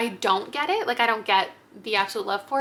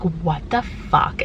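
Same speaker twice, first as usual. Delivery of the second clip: low, slow-drawn "what the fuck"; slow, low-pitched reaction; low-key disbelief; 5 semitones lower, slower than usual. Acted. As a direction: say it deep and drawn out, in stunned disbelief. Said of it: "there are moments where you're like".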